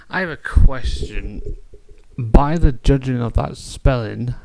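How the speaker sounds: ordinary talk, nothing marked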